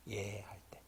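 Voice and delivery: whispered echo. Real, not acted. Preacher echoing Moses' yes, whispered. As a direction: let the echo fade into a whisper. Echoed phrase.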